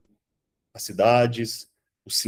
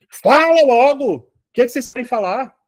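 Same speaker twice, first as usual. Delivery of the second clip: high, loud demand to speak up; impatient shout; 9 semitones higher, 5 dB louder than usual. Retold or imitated. Teacher yelling at spirits blowing in his ear, loud and high. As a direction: bark at the whispering spirits to talk. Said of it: "essa frescuragem de".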